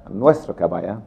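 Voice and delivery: ordinary talk, nothing marked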